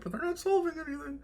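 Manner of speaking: high, soft protesting squeak; mock protest. Retelling his protest to bosses.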